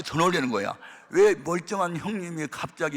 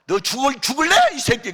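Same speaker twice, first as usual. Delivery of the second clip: higher, louder threat to the son; angry threat; 8 semitones higher, 7 dB louder than usual. Retold or imitated. Father threatening his son over the tablet, angry. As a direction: snarl at him, ready to strike.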